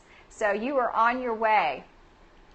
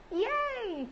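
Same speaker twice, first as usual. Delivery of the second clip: cheering; 12 semitones higher, 5 dB quieter than usual. Real, not acted. Host celebrating a viewer's introduction, high cheer. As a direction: let out a high, drawn-out little cheer.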